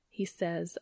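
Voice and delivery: plain reading voice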